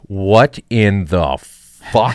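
very slow, stretched out what in the fuck; drawn-out disbelief. Retold or imitated. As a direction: let each word hang in shock. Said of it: "He's like".

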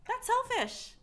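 high, loud comic complaint; funny protest. Real, not acted. Host scolding those who keep gifts to themselves, funny high protest. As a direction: push the pitch way up in playful objection. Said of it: "The way you said that was so funny".

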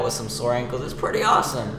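usual speaking voice

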